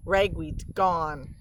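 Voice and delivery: plain reading voice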